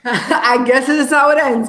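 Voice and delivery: normal talking voice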